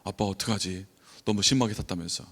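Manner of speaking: ordinary talk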